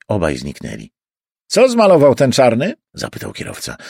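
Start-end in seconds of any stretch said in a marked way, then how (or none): 1.5-2.7 louder, higher cabbie voice; nosy cabbie's raised voice; cab driver. Acted. Driver asking his passenger about the chase, raised cabbie voice.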